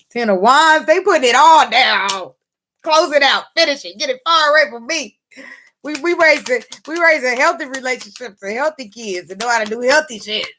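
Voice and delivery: rally them, full of conviction; high, emphatic celebration; fired up. Real, not acted. Fired up preaching.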